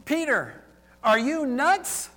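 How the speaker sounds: high, loud "Peter, are you nuts?"; incredulous question to Peter. Retold or imitated. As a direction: challenge him in mock disbelief. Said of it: "may be asking".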